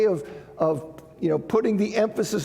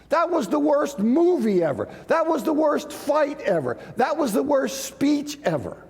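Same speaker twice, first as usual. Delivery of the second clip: higher, emphatic mock outrage, each example hammed up; mock hyperbole; 7 semitones higher than usual. Shown as a demonstration. Teacher to class, showing how we exaggerate, hammed-up emphatic hyperbole. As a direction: play up the exaggeration like everyday overstatement. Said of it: "a way in which we emphasize how terrible".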